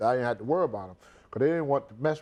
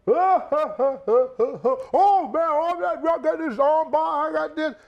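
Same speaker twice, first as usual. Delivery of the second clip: high, mocking wrestler voice; sneering mock boast, high and loud; 13 semitones higher, 4 dB louder than usual. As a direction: parody the gloating wrestlers, pitched way up. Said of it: "they talking about they freaking match".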